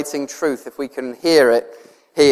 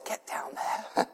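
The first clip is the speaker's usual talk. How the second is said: whispered voice of God; 17 dB quieter, slower than usual. Retold or imitated. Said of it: "and God says".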